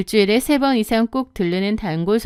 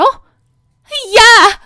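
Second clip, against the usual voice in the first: very high, loud scream of a word; shrieking yell; 12 semitones higher, 9 dB louder than usual. Acted. Sister-in-law screaming at narrator, furious. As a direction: scream her name in rage.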